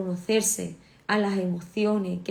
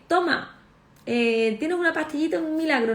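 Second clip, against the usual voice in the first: higher, mocking offer of a miracle pill; mock pill pusher; 6 semitones higher, 3 dB louder than usual. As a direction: parody a salesman handing out pills. Mocking the quick-pill approach. (burla en tono más agudo)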